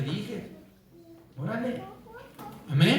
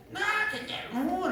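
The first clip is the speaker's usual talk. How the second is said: put-on much higher, whiny child's no; preacher imitating a screaming child in a mall; mock child tantrum; 13 semitones higher than usual. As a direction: imitate a child throwing a fit. Voicing tantrum child refusing.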